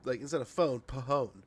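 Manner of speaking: "pahone" said with an extra syllable, pa-hone, after plain "phone"; joke mispronunciation. Shown as a demonstration. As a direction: say "phone" right, then the silly "pahone". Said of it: "I'm joking when I say".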